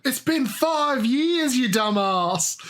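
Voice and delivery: high, drawn-out mock exclamation; mock exasperated outburst. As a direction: act out fed-up disbelief, stretching the words. Voices YouTuber's exasperated outburst.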